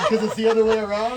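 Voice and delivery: usual speaking voice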